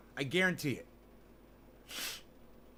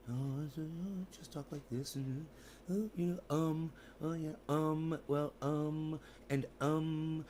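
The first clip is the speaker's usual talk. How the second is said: soft, hesitant halting mumble full of ums; Elon Musk impression; 8 dB quieter than usual. Streamer mocking Elon Musk to his chat, softly halting mumble. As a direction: mumble like a tech billionaire lost for words. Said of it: "my impersonation of Elon Musk".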